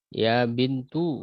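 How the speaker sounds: "Ya bintu" slow, with the final u of bintu clearly sounded; with damma ending. Shown as a demonstration. Teacher shows the damma reading, slowly. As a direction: stretch "bintu" and end firmly on u. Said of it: "dibacanya domah".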